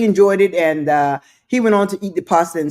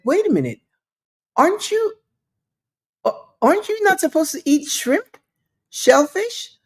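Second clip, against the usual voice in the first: high, incredulous questioning; woman questioning him; 8 semitones higher than usual. Retold or imitated. Woman at the table questioning the guest, high and incredulous. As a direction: raise the voice in mock disbelief.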